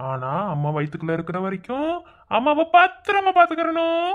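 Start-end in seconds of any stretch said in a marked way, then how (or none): 2.4-4.1 put-on sing-song high plea to the unborn child; pitched way up, coaxing the baby; high baby-talk. Father coaxing the unborn baby in a high voice.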